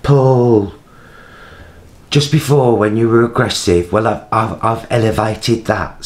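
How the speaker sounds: officious West Midlands woman, imitated; West Midlands accent. CEO's sister reporting carer, mock West Midlands accent. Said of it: "she's West Midlands".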